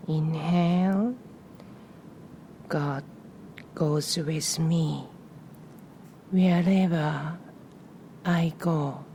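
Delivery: calm meditation voice with long pauses between phrases; guided breathing meditation. Real, not acted. Guiding listeners to inhale and exhale on a prayer, meditative. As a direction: lead the breath gently, phrase by phrase, leaving room after each.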